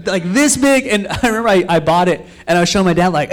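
ordinary talk

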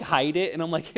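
ordinary talk

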